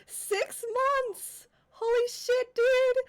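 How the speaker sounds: high, loud, stunned disbelief; shocked. Real, not acted. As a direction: blurt it out in total shock. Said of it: "that just shocked me".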